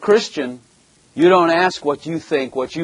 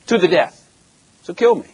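defiant cry; 5 semitones higher than usual. Real, not acted. Defiant preaching climax.

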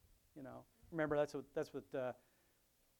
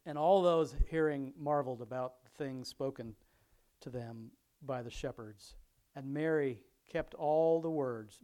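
louder, measured Bible reading voice; scripture reading; 9 dB louder, slower than usual. Acted. Pastor reading Luke aloud to his congregation, louder reading voice. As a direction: read the passage out to the congregation.

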